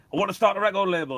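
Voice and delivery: high, loud, fast rush; re-enacted excited phone call; retold eager announcement. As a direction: blurt out the big idea to a friend.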